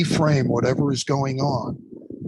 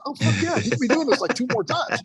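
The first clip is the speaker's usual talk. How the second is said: much higher, gleeful and hyped; gleeful encouragement; 10 semitones higher than usual. Retold or imitated. Instructor hyping up a student to go again, gleeful. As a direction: cheer him on, almost crowing. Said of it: "I said".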